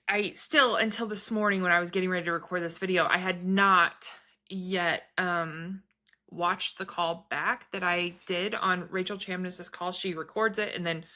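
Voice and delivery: usual speaking voice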